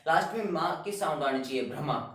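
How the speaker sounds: usual speaking voice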